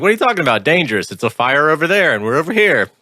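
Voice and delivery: high, scoffing mock of a neighbor's husband; dismissive neighbor. Mocking the neighbor's husband who shrugs off the fire, high scoffing voice. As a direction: brush it off, mocking his disbelief. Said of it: "who's all like".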